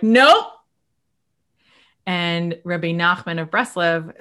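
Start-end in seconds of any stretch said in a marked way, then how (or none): 0.0-0.5 a sudden, louder, higher "nope"; flat refusal. Retold or imitated. Refusing to join others on the elevator, curt dismissive nope. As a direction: shut it down in one word. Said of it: "but you're like".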